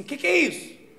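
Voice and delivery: high, loud alarmed question, hammed up; panicked outcry. Stoned partner confronts comedian in car park, acted panic. Panicked girlfriend demanding an answer.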